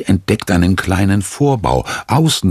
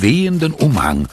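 usual speaking voice

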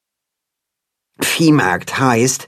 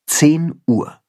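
usual speaking voice

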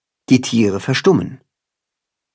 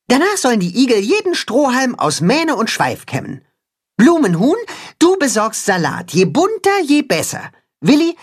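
high, brisk commanding little hen; high cat-hen voice; 10 semitones higher than usual. A narrator's reading. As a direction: give orders like a small general. Katzenhuhn gives orders.

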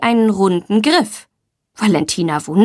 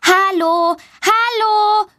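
high, ringing call; calling out loudly; 10 semitones higher, slower than usual. Acted. Girl hearing children's voices calls out, high and loud. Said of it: "ruft sie laut".

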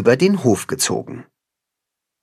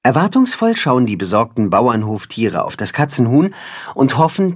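usual speaking voice

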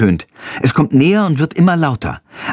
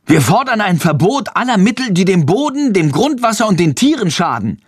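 laut; 8 semitones higher than usual. A narrator's reading. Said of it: "mischt Friedrich sich laut ein".

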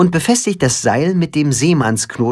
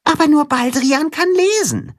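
mole reminding. A narrator's reading. Erinnert ihn der Maulwurf.

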